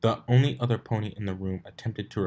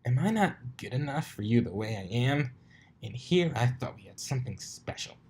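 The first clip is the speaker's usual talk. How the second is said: higher, hammed-up hurt feelings; mock offended; 4 semitones higher than usual. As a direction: pretend to be wounded, overplayed. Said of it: "exaggerated offense".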